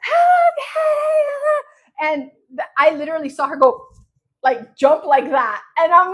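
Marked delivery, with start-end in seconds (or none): 0.0-2.1 drawn-out high shriek, re-enacted; mock superfan screech; imitated fan scream. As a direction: scream it long and high, like calling to an idol from afar. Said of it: "screaming from the entrance of the Universal".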